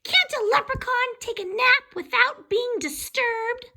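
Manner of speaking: tiny angry leprechaun voice; cross leprechaun. A narrator's reading. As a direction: squeal it while dangling. Leprechaun complains.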